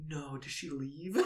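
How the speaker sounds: soft, slow disbelief; retold dismay. Viewer reliving the empty bridge scene, softly dismayed. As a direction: ask it softly, drawn out.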